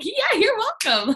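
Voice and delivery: high, slow mock-gracious reply. Answering a grateful prospect with mock graciousness, high and slow. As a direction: play it up like a queen accepting thanks. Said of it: "And I'm like".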